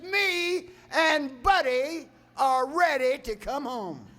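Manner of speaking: loud, high, shouted prayer like a fervent grandma. Retold or imitated. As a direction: imitate her crying out to heaven, stretched and high. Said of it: "her voice starts to raise".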